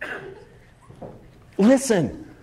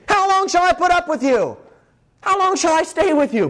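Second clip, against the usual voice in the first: raised-pitch, indignant scolding; exasperated rebuke; 7 semitones higher than usual. Acted. Jesus walks up to the scene, scolding in exasperation. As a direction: cry it out in acted exasperation.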